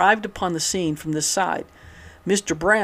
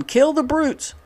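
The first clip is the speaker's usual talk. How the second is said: high, pitched-up mock yell; reading a heckler's yell with a lifted voice; 9 semitones higher, slower than usual. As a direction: toss out a half-hammed yell at the crowd. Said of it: "Dyer dodged the question and yelled on".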